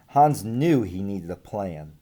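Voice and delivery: usual speaking voice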